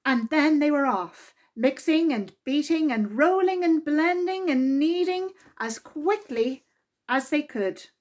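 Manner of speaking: lively, expressive read-aloud voice; children's story reading. A narrator's reading. Narrator reading a Mister Men story to children, lively and expressive. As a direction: read it to a room of small children. Reads picture book aloud.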